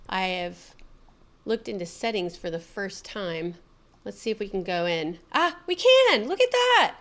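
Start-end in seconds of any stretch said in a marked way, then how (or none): 5.3-6.9 high, loud delighted surprise. Real, not acted. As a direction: gasp with joy at it. Server opens.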